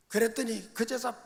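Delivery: ordinary talk, nothing marked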